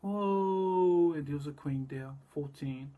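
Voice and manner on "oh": long, loud high "oh"; drawn-out mock cry of someone falling; loud "ohhh" acting out a fall; imitated falling cry. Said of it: "She fell from the roof like this".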